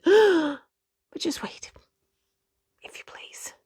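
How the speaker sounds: slow hushed whisper. A narrator's reading. Narrator teasing suspense to children, whispering. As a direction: hold them in suspense, whispering.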